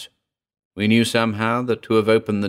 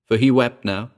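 ordinary delivery

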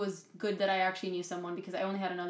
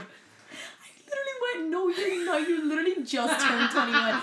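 high-pitched laughing cry; shrieking with laughter at a friend's mistake; 9 semitones higher, 8 dB louder than usual. Real, not acted. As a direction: squeal it out while cracking up. Laughing at friend's age slip.

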